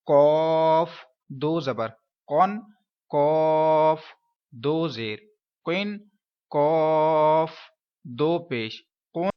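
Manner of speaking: قاف drawn out slowly and full; slow teacher recitation, قاف heavy from the throat; spelling drill. Shown as a demonstration. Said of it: "اب یہ قاف پر ہے تو کیسے پڑھوں گا؟". (قاف کو پُر اور آہستہ پڑھنا)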